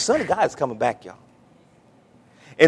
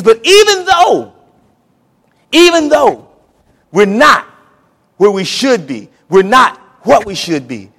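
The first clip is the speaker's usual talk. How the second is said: preaching cry; 6 semitones higher, 8 dB louder than usual. Real, not acted. Preacher crying out God's words to the church. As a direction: cry it out as God's own plea. Voicing God, peak.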